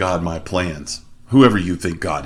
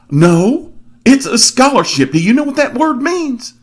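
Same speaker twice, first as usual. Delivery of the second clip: loud, high-pitched Southern exasperation; Southern accent; 7 dB louder than usual. Retold or imitated. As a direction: imitate a Southern man setting someone straight, very high and loud. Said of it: "southern accent, he said".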